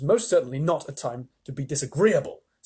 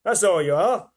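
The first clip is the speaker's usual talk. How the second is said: higher, louder jeering; mocking centipede; 7 semitones higher, 8 dB louder than usual. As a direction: sneer it out loud.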